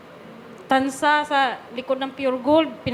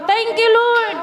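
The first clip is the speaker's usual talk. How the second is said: higher and louder burst of grateful relief; overjoyed praise on hearing the good news; joyful thanks; 8 semitones higher, 4 dB louder, slower than usual. Real, not acted. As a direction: cry out thanks to the Lord. Relief after successful operation.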